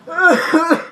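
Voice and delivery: hammed-up sick cough; fake cough. Kid fakes a cough to skip school, hammed-up. Faking sickness.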